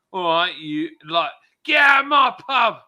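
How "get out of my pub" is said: high, loud Cockney landlord shout; Phil Mitchell. Pretend. Cockney landlord throwing someone out, loud Cockney shout. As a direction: bark it in a Cockney accent like a furious landlord. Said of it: "Everyone do your best for Phil Mitchell accent".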